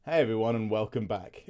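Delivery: storytime intro voice. Retold or imitated. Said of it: "I was not expecting that voice".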